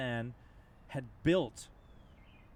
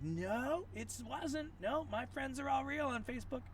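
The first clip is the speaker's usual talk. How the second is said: high-pitched, insistent mock denial, slower; stubborn denier; 13 semitones higher than usual. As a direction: play the woman who refuses to believe it, voice pushed high. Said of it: "She's like".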